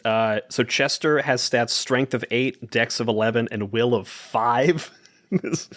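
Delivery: ordinary delivery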